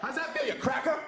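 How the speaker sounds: high, taunting heckler voice; mock taunting heckler. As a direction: jeer at him from way up high.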